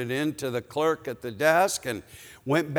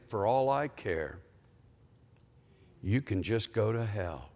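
soft, lowered repeat of the curse; quiet rebuke; 4 semitones lower, 7 dB quieter than usual. Retold or imitated. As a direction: say it gently and low, as God convicting. God's quiet rebuke echoes the yell.

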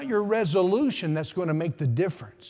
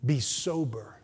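whispered be sober; 4 semitones lower, 5 dB quieter than usual. Shown as a demonstration. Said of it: "who know what it means to watch".

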